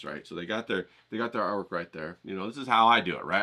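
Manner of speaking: ordinary talk, nothing marked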